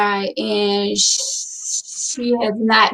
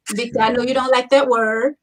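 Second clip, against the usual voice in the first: normal talking voice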